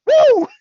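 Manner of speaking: very high, loud whoop; Ric Flair. Acted. Wrestler capping his screamed taunt, mock triumphant whoop. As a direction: let out a hammed-up wrestler's woo. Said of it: "He's just like".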